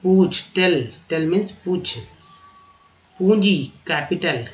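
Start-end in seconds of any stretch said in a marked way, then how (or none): none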